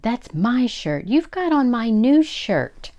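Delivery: put-on high, loud childish complaint; indignant accusation. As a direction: accuse with rising outrage. Husband starts quarrel over shirt.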